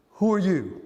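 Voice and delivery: mild surprised question; a startled sleeper asking; startled man. Retold or imitated. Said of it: "one of three ways".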